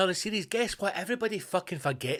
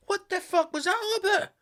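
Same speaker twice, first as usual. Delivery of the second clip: very high-pitched, baffled squeal; incredulous outburst. Real, not acted. Comedian baffled by the witness protection scene, high incredulous squeal. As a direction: let the voice leap up in disbelief. Outburst after mocking the ending.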